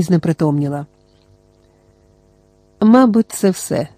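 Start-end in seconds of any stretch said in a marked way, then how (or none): none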